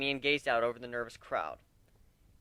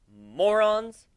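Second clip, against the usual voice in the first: exasperated yell; 8 semitones higher, 10 dB louder than usual. Acted. Scolding nervous new crew.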